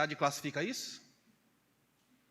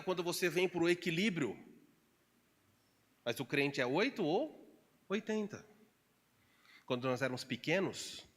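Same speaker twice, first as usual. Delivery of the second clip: ordinary talk, nothing marked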